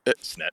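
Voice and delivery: repeats the slip "snet", a mix of "snare" and "set"; blurted "snet" instead of set, set straight. Real, not acted. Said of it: "I've been saying snare a lot today".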